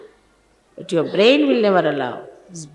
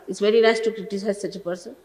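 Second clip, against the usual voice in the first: ordinary talk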